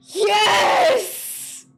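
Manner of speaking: high, loud gasp then Yes; a real shriek of victory; triumphant yes. Real, not acted. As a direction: shout it with sudden joy. It worked.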